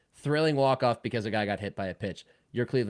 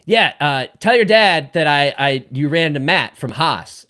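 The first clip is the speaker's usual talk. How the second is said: cheerful stranger; 5 semitones higher, 13 dB louder, slower than usual. Retold or imitated. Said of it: "he goes".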